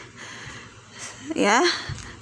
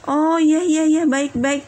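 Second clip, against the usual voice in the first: lightly voiced apologetic listener, higher and louder; acted ready agreement; 5 semitones higher, 3 dB louder than usual. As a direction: agree eagerly, as if just corrected. Bedouin accepts the correction, acted.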